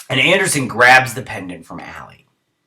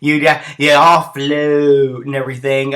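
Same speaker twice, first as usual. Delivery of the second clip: higher, slow, told-you-so tone; mock know-it-all; 3 semitones higher, 5 dB louder, slower than usual. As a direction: drag it out like a smug onlooker. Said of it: "I'm all like".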